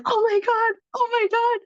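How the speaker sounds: high-pitched, squealing oh my God; excited squeal. Retold or imitated. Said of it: "And she was like".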